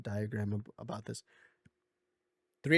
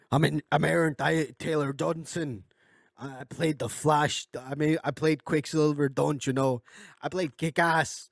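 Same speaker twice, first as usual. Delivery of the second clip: raised, punchy mock bragging; mock indignant boast; 4 semitones higher, 4 dB louder than usual. Upset dad boasting of his acting roles, mock indignant. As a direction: play an upset star listing his roles. Joke rant as the upset actor.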